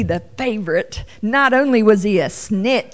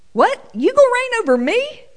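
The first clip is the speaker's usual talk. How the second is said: much higher, fast disbelieving brother; incredulous scoff; 13 semitones higher than usual. Pretend. Brother scoffing at Joseph's dream.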